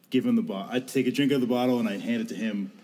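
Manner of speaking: ordinary talk, nothing marked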